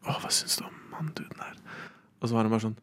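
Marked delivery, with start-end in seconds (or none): 0.1-1.6 acted-out cinema whisper. Whispering to classmates at the cinema as a child, hushed whisper. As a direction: whisper it softly to the person next to you. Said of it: "Og så hvisket jeg til de".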